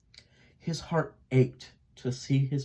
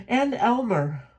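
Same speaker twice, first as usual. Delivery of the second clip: high, raised mother's call; a mother lifting her voice; 10 semitones higher than usual. A narrator's reading. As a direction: call out over the flock. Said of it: "Mama called out".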